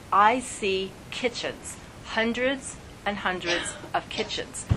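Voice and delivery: slow, soft, savoring each word; picture endless kitchens, almost reverent; a slow, soft dreamy wonder. Retold or imitated. Friend describes his vision dreamily.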